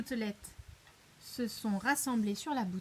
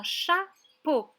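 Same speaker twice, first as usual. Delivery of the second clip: "chapeau" slowly split cha, then po; syllable by syllable; 4 semitones higher, 5 dB louder than usual. Shown as a demonstration. Teacher asks where the O is, syllable by syllable. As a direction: leave a gap inside "chapeau". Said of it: "dans la deuxième syllabe".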